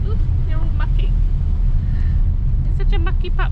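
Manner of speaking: high sing-song scolding for the dog; dog-talk voice. Real, not acted. Talking to the dog.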